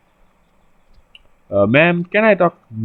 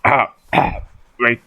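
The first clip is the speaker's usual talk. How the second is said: ordinary talk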